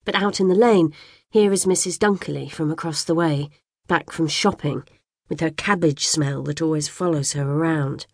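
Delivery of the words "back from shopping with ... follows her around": plain reading voice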